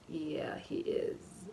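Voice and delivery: unvoiced nervous whisper; imitating a scared boyfriend's whisper. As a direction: breathe the agreement quietly.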